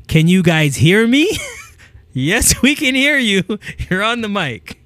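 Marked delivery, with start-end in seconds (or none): 0.1-1.3 louder, hammed-up nervous mic check; mock mic check. Coach mimicking a nervous best man testing the mic, loud. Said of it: "they say this word". 2.1-3.4 higher, louder, teasing exasperation; mock audience reply. Coach voicing the crowd answering the speaker, mock exasperated.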